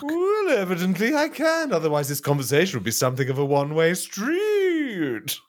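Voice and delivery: high, snooty put-down; haughty chairman. Acted. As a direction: sneer it down at him. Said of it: "reenact it with you two".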